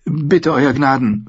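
slow, begging plea; pleading. A narrator's reading. Sansa to the king, pleading. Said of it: "flehte sie".

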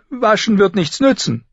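put-on high, loud girl's rebuff; dismissive girl. Sansa rejects her sister's offer, dismissive.

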